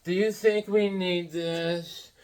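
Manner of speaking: slow, drawn-out question; slow talking. Retold or imitated. Mimicking Hawkwoman's slow, dawdling talk in the store. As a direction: stretch every word out slowly. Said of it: "slow walking and slow talking".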